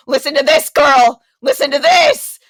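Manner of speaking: loud, rising to a high yell; furious friend. Retold or imitated. Stranded friend yelling on the phone, loud and high. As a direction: shout it so she can hear the airport. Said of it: "he was like".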